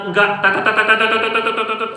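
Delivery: a stream of quick tet tet tet chatter; rapid chatter imitation. Preacher mocks someone who talks without pause, rapid tet tet. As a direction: rattle it off like nonstop talk. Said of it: "ngomong tuh nyerocos saja".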